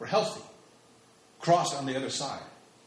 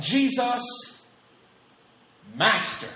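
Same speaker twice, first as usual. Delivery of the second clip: loud, raised cry from afar; calling out; 6 semitones higher, 5 dB louder, much slower than usual. Acted. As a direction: call out to be heard at a distance. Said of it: "And they called out".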